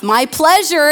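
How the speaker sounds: cheery Chick-fil-A reply. Retold or imitated. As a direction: chirp "My pleasure" like a happy cashier. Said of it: "is what they say to everything".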